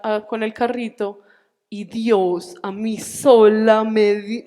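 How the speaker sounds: ordinary talk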